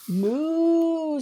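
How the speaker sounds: big moo, high and loud. Acted. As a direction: bellow the moo at the mouse. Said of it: "just as loudly as possible".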